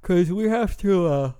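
slow words through a yawn. Real, not acted. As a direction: talk while yawning. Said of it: "sorry I keep yawning".

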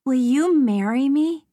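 put-on high, loud eager child; a small girl boldly proposing; little girl voice. A narrator's reading.

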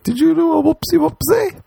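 very high cooing question; high baby talk. Pretend. The girl asks him, very high cooing baby talk. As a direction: coo it like talking to a baby.

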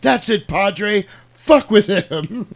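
slow, high line breaking into a laugh; mocking James Woods. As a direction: ham it up slowly and crack up at the end. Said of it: "James Wood's like".

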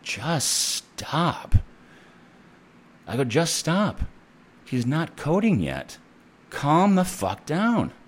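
hammed-up order to stop panicking; exasperated. Nurse tells panicking coworkers to calm down, high exasperated mock scold. Retold telling off frantic nurses.

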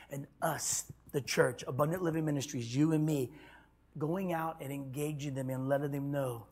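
soft, low, intimate, nearly whispered; hushed preaching. Acted. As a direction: pull them in close with a hush. Preacher drops to a hush.

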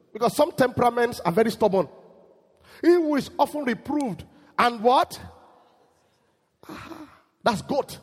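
high, loud, driving pulpit voice; fiery preaching. Acted. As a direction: hammer the point home to the congregation. Preaching at full force.

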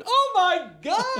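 very high, drawn-out "oh my God"; high mock exclamation. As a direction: squeal it slowly in imitation. Retold excited on-stage reaction.

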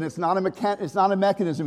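ordinary talk, nothing marked

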